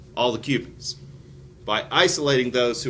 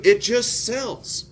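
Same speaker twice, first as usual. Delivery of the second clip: slow emphatic; 12 semitones higher, 4 dB louder, much slower than usual. Acted. Punchline slowed down.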